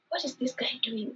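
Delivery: stunned whisper to yourself; incredulous whisper. Retold or imitated. Woman baffled as he walks away, whispering. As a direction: whisper it in disbelief.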